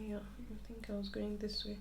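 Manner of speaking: very soft thinking aloud; talking to oneself. Real, not acted. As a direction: murmur it privately, retracing your thoughts. Lost thread, talking to herself.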